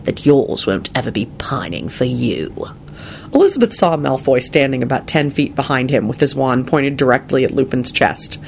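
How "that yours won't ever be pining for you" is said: low, breathy icy retort; low whispered chill; very cold. A narrator's reading. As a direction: deliver the jab coldly under the breath. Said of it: "he responded very coldly".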